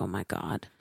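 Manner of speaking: low, soft, sinking reaction; retold quiet dread, pitched well down; deflated dismay. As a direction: let it drop under your breath.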